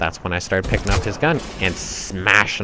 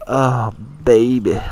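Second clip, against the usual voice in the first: slow, drawn-out imitation of a lover; 3 semitones higher, slower than usual.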